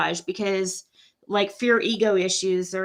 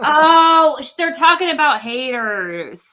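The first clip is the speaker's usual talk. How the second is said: loud, high "oh" of dawning understanding; sudden realization; 4 semitones higher, 9 dB louder, slower than usual. Real, not acted. Host finally understands the chat comment, surprised realization. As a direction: burst out as it finally clicks.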